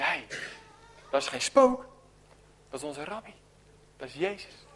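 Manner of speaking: hushed awe. Acted. Disciples see their rabbi on the water, hushed. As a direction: realise it quietly, in wonder.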